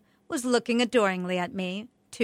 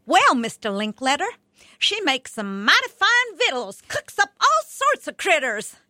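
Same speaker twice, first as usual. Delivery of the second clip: put-on twang it out like Ellie Mae with a Southern accent; thick Southern accent; 8 semitones higher, 9 dB louder than usual. A narrator's reading. Girl answers the TV host in a put-on Southern accent. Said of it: "thick Southern accent and all".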